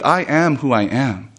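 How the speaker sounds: slow, plain statement without sass; simple truth. Acted. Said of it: "without sass, without any kind of childishness".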